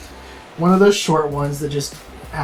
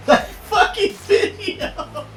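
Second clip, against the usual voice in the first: laughing so hard it nearly cries; words squeezed through laughter; real breathless laughter; 7 semitones higher, slower than usual. Real, not acted.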